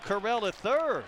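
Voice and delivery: high, lifted play-by-play punch; excited strikeout call. Real, not acted. Announcer calling the strikeout by the third base umpire, excited. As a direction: ring up the batter with excitement.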